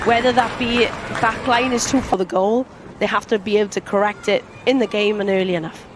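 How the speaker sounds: ordinary talk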